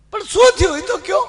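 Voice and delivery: high, eager mother-in-law greeting. Acted. Mother-in-law answers the crying son-in-law. (ઊંચા અવાજે હરખાતી સાસુ)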